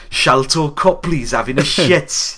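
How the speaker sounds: slow Geordie narrator drawl; deadpan Geordie announcer voice; Geordie accent. Retold or imitated. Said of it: "doing the Geordie accent".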